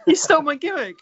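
said through laughter; laughing. Real, not acted. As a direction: laugh while protesting. Laughing retort.

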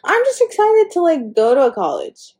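high, bright burst of excitement; excited. Real, not acted. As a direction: squeal it out with glee. Excited about college.

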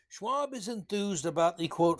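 ordinary delivery

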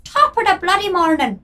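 high, put-on Irish lilt; leprechaun Irish. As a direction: do a hammed-up Irish leprechaun greeting. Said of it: "See, I'm like a leprechaun".

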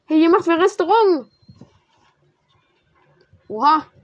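loud, high exclamations; excited shouting. Real, not acted. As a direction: cheer out each reaction. Excited reactions in game.

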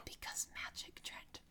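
hushed, breathy aside; whisper. Real, not acted. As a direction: whisper it like a secret. Aside answering the co-host.

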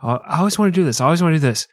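playful acted insistence; eager daredevil. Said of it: "He's like".

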